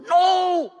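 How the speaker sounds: high, loud starstruck "no"; freaking out. Retold or imitated. Fan answering his publicist's question, freaking out. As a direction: squeal it like an overexcited fan. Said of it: "and I freaked out".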